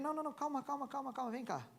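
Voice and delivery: hushing a crying wife gently; a soft, high calm-down. Retold or imitated. (acalmando a esposa com voz suave e aguda)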